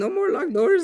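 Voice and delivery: quoting a clip to friends, high and squeaky; squeaky high quote. Pretend.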